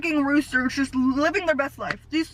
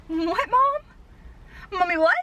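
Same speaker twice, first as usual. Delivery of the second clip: baffled reaction; 6 semitones higher, much slower than usual. Retold or imitated. Daughter reacting to mom's lost rooster news, baffled reaction. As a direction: act out disbelief, pitch leaping up. Acts out own baffled reply to mom.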